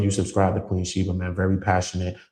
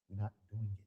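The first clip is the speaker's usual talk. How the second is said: "We're not doing it" dropped much softer; quiet repeat; 16 dB quieter, faster than usual. Real, not acted. Speaking to his viewers, soft resolved repeat. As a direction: let the refusal sink to a hush.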